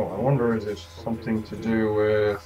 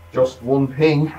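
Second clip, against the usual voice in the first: Sean Connery impression; 4 semitones higher, 7 dB louder, slower than usual. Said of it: "my best Sean Connery accent".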